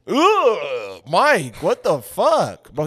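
high, slow, grossed-out groan; disgusted. Real, not acted. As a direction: recoil in disgust at a friend. Disgusted at cold boiled egg.

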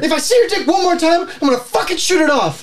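high-pitched furious warning; heated ultimatum; angry threat. Acted. Acted threat in quarrel.

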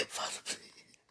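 breathless whispered prayer; whispered plea. Real, not acted. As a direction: barely breathe the words.

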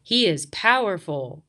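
ordinary delivery

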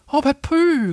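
high, loud, excited cry. A narrator's reading. Piglet crying out to Pooh, all excited. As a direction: pipe up high, bursting with excitement. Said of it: "cried Piglet, all excited".

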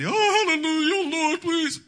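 high, loud mock cry to the Lord; pleading fake scream of hallelujah; mock desperate plea. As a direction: ham up a panicked prayer. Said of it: "you come in here screaming".

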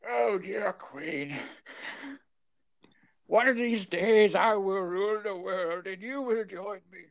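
high, slow creepy villain voice; creepy old man voice. Retold or imitated. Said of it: "creepy old man".

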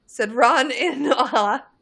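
laughing. Real, not acted. Laughing while reading line.

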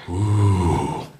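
low hushed ooh; a low worried gasp; crowd gasp ooh. Acted. Crowd watching the wobble: low ooh.

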